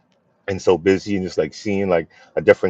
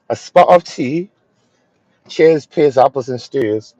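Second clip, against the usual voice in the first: lightly put-on British accent, higher and slow; mock British accent; 5 semitones higher, slower than usual. Joking to an English viewer in a mild mock British accent. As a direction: tease in a hammed-up British accent. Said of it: "I'm about to be a little, uh, funny".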